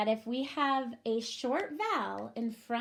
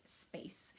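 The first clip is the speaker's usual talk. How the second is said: whispered word; 19 dB quieter than usual. Shown as a demonstration. Teacher whispering the blended word space. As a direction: space whispered under the breath. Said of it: "teacher blending word".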